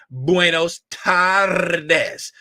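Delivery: "Buenos tardes" very slow, R in "tardes" rolled. Shown as a demonstration. Greeting viewer Valdez, slow with a rolled R. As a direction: stretch out "Buenos tardes" and roll the R. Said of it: "You see, I rolled them R's".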